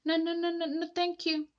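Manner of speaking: high, loud hurried refusal; high peep. A narrator's reading. Thomas refusing Henry's dare, high nervous peep. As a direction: squeak out the refusal, backing off. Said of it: "Thomas peeped".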